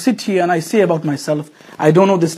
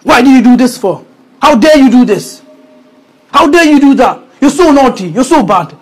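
higher, louder scolding parent; angry parent; 6 semitones higher, 6 dB louder than usual. Retold or imitated. Angry parent shouting at a naughty child. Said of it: "and parents are angry".

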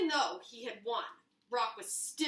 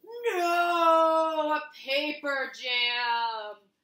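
much louder, slow trailer voice; dramatic reveal; 3 semitones higher, 10 dB louder, much slower than usual. Acted. Revealing Paper's win by a paper jam, slow dramatic boom. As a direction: stretch it out for drama.

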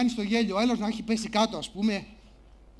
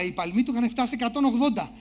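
normal talking voice

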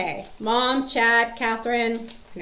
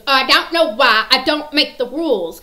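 loud, higher, grouchy movie impression; cranky old woman; 5 semitones higher, 12 dB louder than usual. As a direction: snap it like a cantankerous old lady. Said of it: "being her cranky old self".

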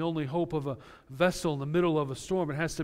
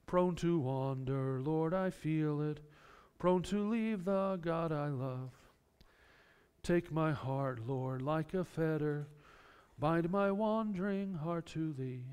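slow and softer, hymn words savoured; slow, hushed hymn recitation; reciting a hymn; 5 dB quieter, slower than usual. Retold or imitated. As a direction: let the old hymn lines linger gently. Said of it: "the old hymn".

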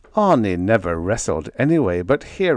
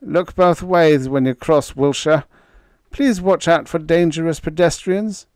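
nervous; 8 semitones higher, 4 dB louder than usual. A narrator's reading. Nervous doctor's fussy farewell.

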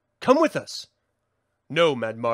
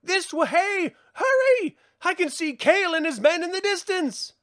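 urgent calling out; 9 semitones higher than usual. Acted. Urging fugitives on.

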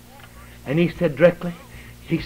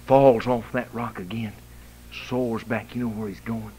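usual speaking voice

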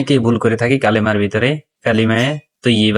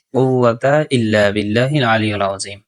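normal talking voice

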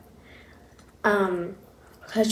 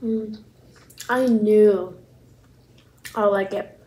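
speaking with food in mouth; words between bites; talking while chewing; slower than usual. Real, not acted. Seen 3: Took a bite just before; lips pressed, jaw working between words.

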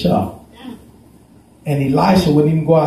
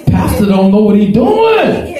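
mock scornful shout; 5 semitones higher, slower than usual. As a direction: hurl it back as the scornful servant. Servant's quote repeated, shouted.

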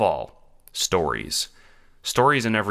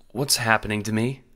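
put-on higher, mildly worried child's voice; voice cracking slightly; 4 semitones higher than usual. A narrator's reading. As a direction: ask with a touch of a wavering voice. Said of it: "voice cracking a little".

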